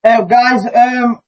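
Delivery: muffled headphone mic sound; distant tinny headset audio; headphones used as mic. Real, not acted. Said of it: "I'm talking through my headphones now".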